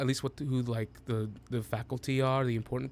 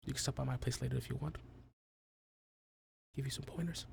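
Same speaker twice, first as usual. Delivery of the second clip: quick quiet invite; 11 dB quieter, much faster than usual. Acted. Luca's quick flirtatious invitation.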